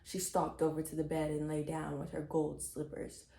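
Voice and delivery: ordinary delivery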